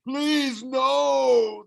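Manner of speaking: high, loud begging cry from a hurt giant; pitiful monster plea. A narrator's reading. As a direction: beg for mercy like a wounded Cyclops. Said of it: "the Cyclops moaned pitifully".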